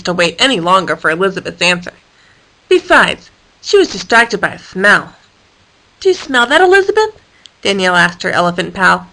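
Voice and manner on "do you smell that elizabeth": high questioning voice. Acted. Danielle asks, rising high.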